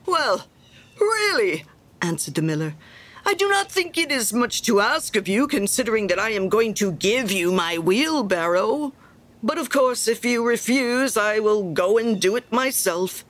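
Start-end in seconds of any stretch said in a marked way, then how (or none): 0.0-1.6 high, falling exclamations, set apart by a pause; affronted miller. Acted. Miller reacting to Hans wanting to refuse, affronted. 3.2-13.3 loud, emphatic huff with swooping pitch; affronted miller. Acted. Miller reproaching Hans over the wheelbarrow, huffy.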